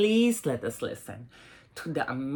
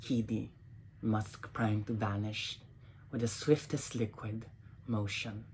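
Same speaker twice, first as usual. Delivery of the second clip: soft, measured poem reading with long pauses; poetry reading; 3 semitones lower, 5 dB quieter than usual. Acted. Reading her poems to an audience, soft and measured. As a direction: let each image hang before the next line.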